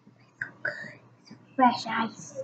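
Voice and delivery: hushed unvoiced whisper. Real, not acted. Girl whispering to viewers about her icy water. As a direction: whisper it like a treat.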